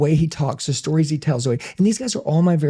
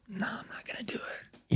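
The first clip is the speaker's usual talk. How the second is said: hushed, voiceless whisper; whispered refusal; 6 semitones higher, 16 dB quieter than usual. Retold or imitated. Man privately refusing the preacher's call, whispering.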